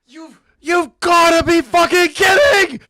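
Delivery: loud, slow, high outraged yell; disbelieving shout. Real, not acted. Streamer outraged at clip.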